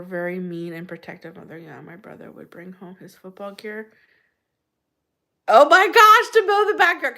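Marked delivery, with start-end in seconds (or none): 5.5-7.2 excited. Real, not acted. Startled exclamation mid-story.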